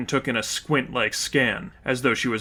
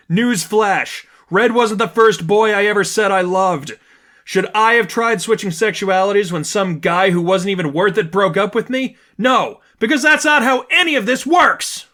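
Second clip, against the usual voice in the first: loud, much higher, furious and scornful; angry outburst; 8 semitones higher, 5 dB louder than usual. A narrator's reading. Stephanie yelling at her friend, furious and scornful. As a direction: hurl it at her, all condescension. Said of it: "anger and condescension mixed".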